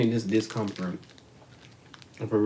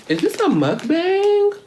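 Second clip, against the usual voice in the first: slow, very high playful squeak; squeaky high voice; 7 dB louder, slower than usual. Pretend. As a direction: ask it in a silly squeaky voice. Playful question about eating on camera.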